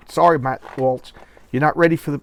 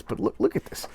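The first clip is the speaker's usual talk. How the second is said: whispered disgust; 8 semitones higher, 6 dB quieter than usual. Real, not acted. Disgust at comic page.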